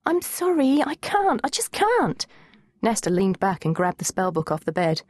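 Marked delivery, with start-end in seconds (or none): 0.1-2.2 high, wailing apology; plaintive refusal. A narrator's reading. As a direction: plead helplessly, pitched well up. Girl pleads she cannot do it.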